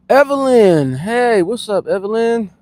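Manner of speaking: high, loud hello; excited greeting. Real, not acted. Greeting a viewer named Evelyn, excited high call. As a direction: sing out the name as you greet.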